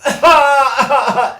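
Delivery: high, loud burst of "ah-ah-ah"; imitated baby cry. Shown as a demonstration. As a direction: mimic a baby's laughing wail. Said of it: "they laugh and cry".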